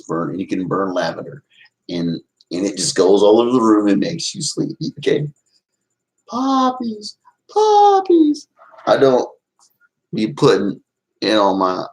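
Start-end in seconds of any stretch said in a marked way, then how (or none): 6.3-8.5 high screechy poppies, rising louder; Wicked Witch cackle. Pretend. Streamer hams up the witch chanting poppies, high and loud. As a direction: screech it like a witch over her crystal ball.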